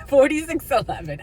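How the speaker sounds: usual speaking voice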